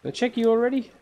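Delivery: high sing-song pet talk. Real, not acted. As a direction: coo at the little snail. Talking to a snail.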